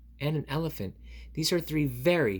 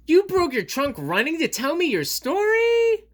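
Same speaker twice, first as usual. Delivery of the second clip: much higher, louder outcry; exasperated Piggy; 10 dB louder than usual. Acted. Piggy hears Gerald broke his trunk, high outcry. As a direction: cry out in disbelief.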